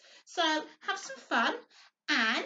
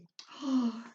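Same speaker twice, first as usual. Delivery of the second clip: mock gasp. Reacting to sore throat news.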